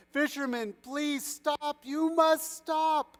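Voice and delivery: high, urgent plea; begging. A narrator's reading. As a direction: plead in panic, drawing out the final stop. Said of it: "began to cry and beg".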